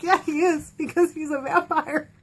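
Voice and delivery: deadpan the obvious; sarcastic aside. Retold or imitated. Fan answering the mom sarcastically. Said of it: "I'm like".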